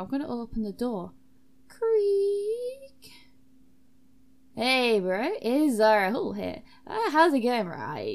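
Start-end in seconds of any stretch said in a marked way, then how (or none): 1.7-3.1 long, high, loud door creak; creaking door imitation. Player opening the door in the game, high drawn-out creak. 4.5-8.1 loud, matey character voice; loud bro greeting. Acted. Zara Hall answering the door to a visitor, loud bro voice.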